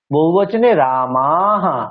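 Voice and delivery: রামাহা with a slow stretched aa and a final ha; long aa with visarga. Shown as a demonstration. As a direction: drag out the aa of রামাহা, end on visarga. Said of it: "আকারটা টানতে হবে".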